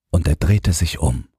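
ordinary delivery

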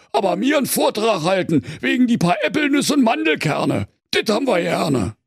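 high, mocking bear voice; grumbling bear. A narrator's reading. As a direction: bear complaining.

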